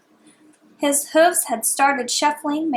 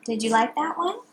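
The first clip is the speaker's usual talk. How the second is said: plain reading voice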